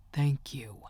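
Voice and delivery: barely-there whispered thanks; mouthed whisper. A narrator's reading. Policeman silently thanking the narrator, whispered. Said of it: "mouthed the words".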